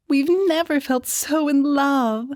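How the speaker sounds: high, slow, lovestruck gush. A narrator's reading. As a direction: lay on the sweetheart act, drawn out. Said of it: "Cordetta gushed".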